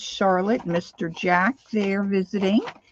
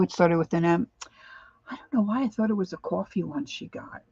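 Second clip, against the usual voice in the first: ordinary talk, nothing marked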